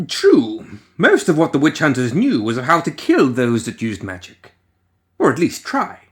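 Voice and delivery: ordinary delivery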